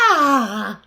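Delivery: loud happy "ah"; delighted sigh. Acted. Bear enjoys food, delighted loud ah.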